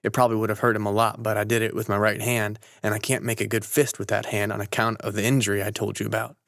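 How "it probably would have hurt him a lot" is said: usual speaking voice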